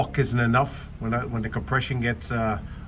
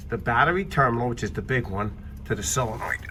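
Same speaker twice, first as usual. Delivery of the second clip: usual speaking voice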